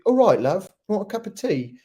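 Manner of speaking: high-pitched mock-British tea offer; British accent. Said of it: "trying to do his British accent here".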